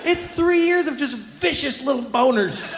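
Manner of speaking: high, slow, cracking comic wail; strained near-crying whine; mock anguish. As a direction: wail it like a tortured kid. Anguished complaint continues.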